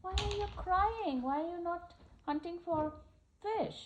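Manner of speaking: a small crab puzzled by the crying; crab's question. Acted. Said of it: "So the crab came and asked".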